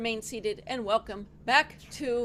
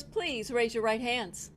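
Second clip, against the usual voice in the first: ordinary delivery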